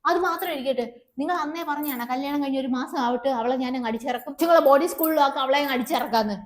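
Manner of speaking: normal talking voice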